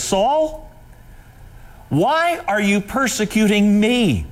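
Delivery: high, pleading call from heaven; voice of Jesus. Acted. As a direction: call his name up high, then ask gently. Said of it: "he comes to Saul and he says".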